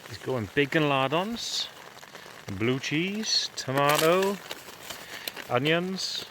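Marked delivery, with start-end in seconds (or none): none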